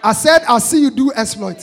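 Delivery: loud prophetic shout; preaching shout. Acted. Preacher prophesying over the congregation, loud shout. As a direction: declare it over them.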